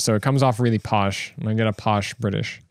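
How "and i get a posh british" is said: ordinary delivery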